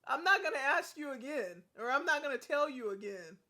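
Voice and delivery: stern warning. Real, not acted. Warning after yelling stop.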